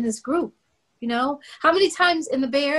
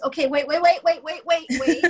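flustered wait; 2 semitones higher than usual. Retold or imitated. Flustered stopping the news.

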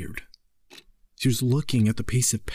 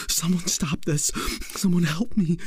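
usual speaking voice

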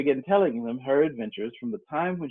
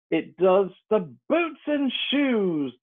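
solemn; 8 semitones higher, much slower than usual. Acted. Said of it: "the Griffin replied very solemnly".

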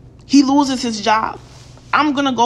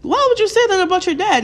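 incredulous, higher, with a laugh; shocked what the fuck; 7 semitones higher than usual. Retold or imitated. Telling young men who curse their fathers, incredulous laughing disbelief. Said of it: "I'm like".

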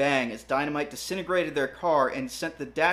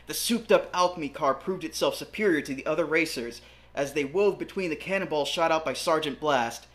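plain reading voice

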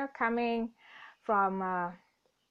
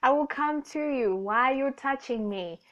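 ordinary delivery

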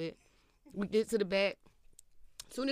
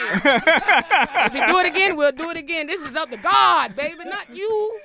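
hammed-up loud pastor; mock preacher; 5 semitones higher, 8 dB louder than usual. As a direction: preach it from the pulpit.

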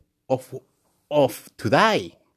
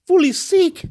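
higher and louder bragging cry; hyped; 13 semitones higher, 5 dB louder than usual. Real, not acted. Boasting to the camera his work is fully sick, hyped. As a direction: crow it out proudly.

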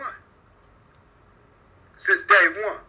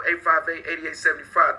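ordinary talk, nothing marked

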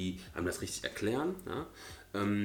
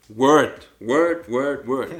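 louder, bouncy repeated calls; playful shouts; 14 dB louder, much slower than usual. Real, not acted. Host loudly echoes co-host's "word" as a playful hype cheer.

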